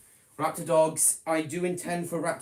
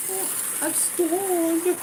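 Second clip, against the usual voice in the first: silly voice; slower than usual. Pretend. Train stalls again.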